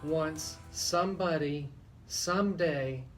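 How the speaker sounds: ordinary talk, nothing marked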